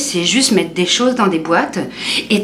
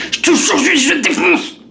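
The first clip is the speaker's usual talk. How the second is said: furious threat; 8 semitones higher than usual. Retold or imitated. Dad's rage peaks.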